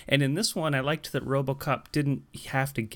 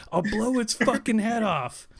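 higher-pitched mock threat, not truly screamed; mock shriek; 8 semitones higher than usual. Retelling the drugged-out guy with the baby, mock shriek. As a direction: imitate a frantic thug's shriek, half-voiced. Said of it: "the guy is shrieking".

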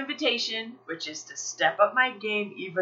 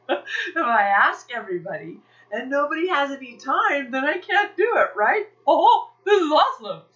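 high, loud, laughing delight; giddy mock triumph with laughs; gleeful relief; 7 semitones higher, 7 dB louder than usual. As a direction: crow over finding a way out. Gleeful at an excuse to quit.

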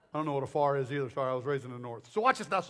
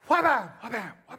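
punchy imitated door bang, fading; slamming door noise; 5 semitones higher, 6 dB louder, faster than usual. Preacher imitating the back door slamming, punchy and fading. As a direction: make the door slam and bounce.

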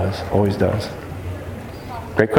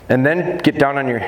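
normal talking voice

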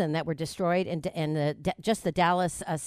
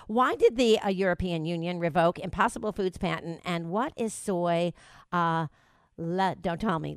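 ordinary delivery

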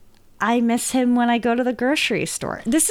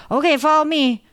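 put-on high, bright little-boy voice, a bit louder; 8 semitones higher, 5 dB louder than usual. Owner voicing her cat Monty as a little boy, high and eager. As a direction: play a bossy little boy leading the way. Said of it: "little boy voice".

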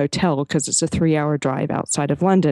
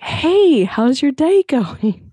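put-on very high, slow, over-the-top English accent; 10 semitones higher, 4 dB louder, much slower than usual. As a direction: ham up a chirpy English clerk's greeting. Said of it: "I can't do a proper English accent".

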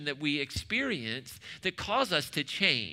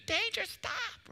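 "Danger, stop" pitched far higher and slower, an imitation parent's scream; mock scream; slower than usual. Preacher imitating a parent screaming at a child near the street. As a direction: shriek it like a parent whose kid runs into the street. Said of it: "So you just start screaming to get their attention".

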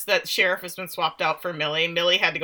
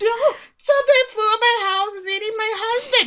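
much higher, squeaky mock panic; panicked woman; 14 semitones higher, 5 dB louder than usual. Host imitating the woman screaming about her husband. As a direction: play a frantic, silly victim. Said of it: "And I was like".

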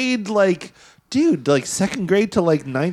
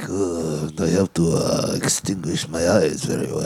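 much lower, put-on Japanese-accented English; Shokasugi accent; 12 semitones lower, 3 dB quieter, slower than usual. Friend mocking the actor's eyeliner reasoning, in a low put-on Japanese accent. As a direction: lampoon a martial-arts star's accent, voice dropped low. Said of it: "why you have to do the Shokasugi accent".

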